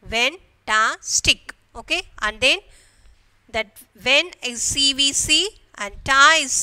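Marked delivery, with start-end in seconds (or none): 0.1-1.5 "Fantastic" slowly in three separated beats; syllable division. Shown as a demonstration. Teacher demonstrating syllable division, "Fantastic" broken in three. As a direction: pause after each syllable of "Fantastic". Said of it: "Syllable division".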